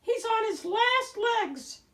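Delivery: high, loud little squirrel voice; a squeaky young squirrel mocking the wolf; high squirrel chatter, loud. A narrator's reading. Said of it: "one of them said".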